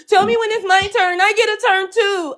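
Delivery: put-on high whiny toddler voice; whiny man child. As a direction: whine it like a toddler who wants a turn. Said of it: "Now he whining like a toddler".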